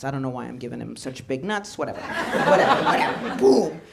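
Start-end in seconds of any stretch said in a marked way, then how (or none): 2.5-3.8 giggling through the word; laughing at your own bit. Real, not acted. Comic to crowd, laughing out the word.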